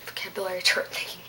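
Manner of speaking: Vocabulary chart thingy whispered, fast. Real, not acted. Teacher whispers her own phrase back mockingly. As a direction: whisper the phrase under your breath. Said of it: "unvoiced whisper".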